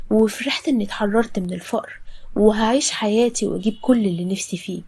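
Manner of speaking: usual speaking voice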